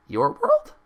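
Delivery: squeaking, very high and loud; shocked. Acted. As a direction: gasp it out in shock. Pinkie shocked.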